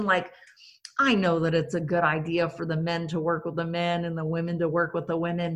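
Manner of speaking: ordinary talk, nothing marked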